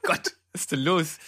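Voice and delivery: struggling to recover from choking; choking on a drink. Real, not acted. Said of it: "Stirbst du gerade?". (nach dem Verschlucken gepresst)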